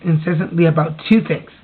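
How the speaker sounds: ordinary delivery, nothing marked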